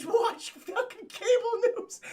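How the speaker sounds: high, slow through laughter; laughing. Real, not acted. Streamer laughing at Aunt May's cable news habit, high and slow. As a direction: giggle out the punchline.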